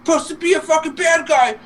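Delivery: very high-pitched mock nagging; complaining wife. Retelling Bowser's wife complaining, high nagging voice. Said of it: "you gotta see his wife be bitching about".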